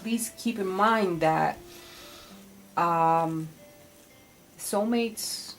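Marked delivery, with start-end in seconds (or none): none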